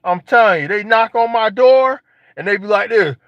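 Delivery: usual speaking voice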